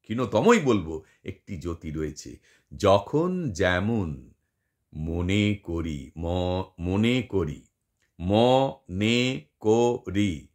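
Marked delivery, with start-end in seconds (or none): 2.8-4.3 very slow, each syllable pulled apart. Shown as a demonstration. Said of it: "জ আর খয়ের মধ্যে খুব ক্ষীণতর". 4.9-10.5 very slow, মনে করি pulled apart into মo-নে-কো-রি; syllable by syllable. Shown as a demonstration. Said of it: "ম আর নের মাঝে আছে".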